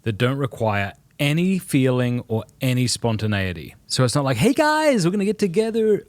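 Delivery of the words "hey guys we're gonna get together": put-on chirpy, much higher American accent; bright American-accented call to the group. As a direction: hail the guys like a cheery American. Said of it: "I have an American accent when I do that".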